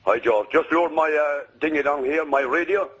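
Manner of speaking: ordinary delivery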